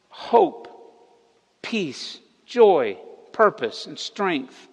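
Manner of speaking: each word in the list set apart with pauses; building sermon appeal. Acted.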